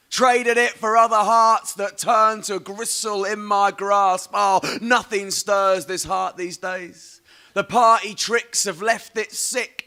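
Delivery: slow, high, drawn-out poetry reading; poem recital. Acted.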